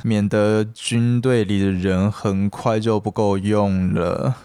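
put-on slow, slurred drunk rambling. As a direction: talk like a tipsy man at the bar. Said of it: "我尽力的去模仿一个喝醉酒的人".

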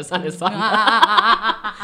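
drawn out very slowly through a laugh; a lazy shrug of a line, giggling. Retold or imitated. Mimicking crew shrugging it off.

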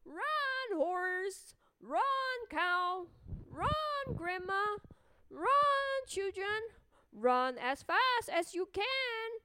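high, loud teasing call; taunting shout. Acted. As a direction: yell it like a cheeky runaway. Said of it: "laughs and shouts".

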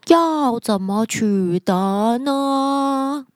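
unhurried, drawn-out sloth; slow sloth voice. Acted. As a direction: ask slowly and lazily. Sloth asks sister sloth.

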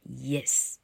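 yes slowly, with the y glide lengthened; drawn-out, careful yes; model pronunciation. Shown as a demonstration. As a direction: demonstrate the y at the start of yes. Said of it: "[name]念给你听哦".

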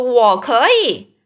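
loud and slow, drawing it out; hammed-up stubborn child; stubborn insistence. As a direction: dig in and stretch each word. Quarrel escalates, drawn out.

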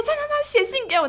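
put-on high taunting boy voice; imitating the boy bragging; mocking boy. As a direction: tease it to the whole class. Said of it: "他说".

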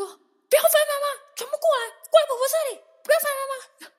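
put-on sing-song grandma herding kids away from mom; grandma calling the kids. Grandma calls the kids away from their crying mom, high and loud. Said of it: "我妈说".

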